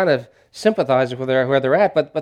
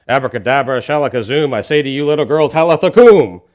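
hammed-up conjurer's incantation, last words louder; mock magic spell; 6 dB louder than usual. Preacher mocking a wannabe necromancer's spell, mock incantation. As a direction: intone it like a stage magician casting a spell. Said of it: "not some magical formula for wannabe necromancers".

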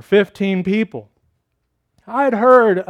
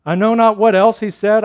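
normal talking voice

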